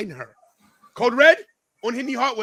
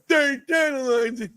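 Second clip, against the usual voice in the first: high catchphrase call; 7 semitones higher than usual. Acted. Repeats his catchphrase for effect.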